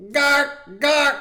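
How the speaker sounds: very high loud gawk sounds; crude mock noise. Comic adding a crude mock sound effect. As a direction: squawk it crudely.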